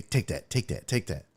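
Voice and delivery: low, soft repeated ad-lib. Retold or imitated. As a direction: mutter it low like a rapper's ad-lib. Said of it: "like she's [name]".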